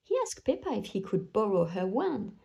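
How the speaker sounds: ordinary delivery, nothing marked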